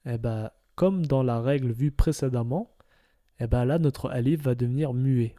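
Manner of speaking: ordinary talk, nothing marked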